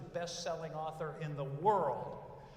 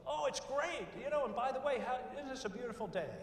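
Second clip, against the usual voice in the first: high, upbeat gushing, much higher; cheerful server; 7 semitones higher than usual. Retold or imitated. Happy server chats up a diner, high and upbeat. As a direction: gush like the happiest waiter alive. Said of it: "the server comes up and says".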